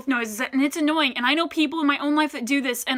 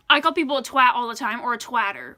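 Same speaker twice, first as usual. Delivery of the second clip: ordinary talk, nothing marked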